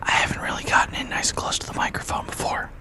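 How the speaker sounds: whispering close to the mic; right in your ear. Real, not acted. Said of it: "I'm whispering in your ear".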